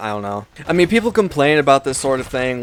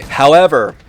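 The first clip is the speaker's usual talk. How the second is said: HOWEVER punched loud and higher, then falling; emphatic HOWEVER; 4 semitones higher, 8 dB louder than usual. Real, not acted. Turning from faint praise to criticism, emphatic HOWEVER. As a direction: hit "However" hard as a big turning point. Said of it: "this is a big however".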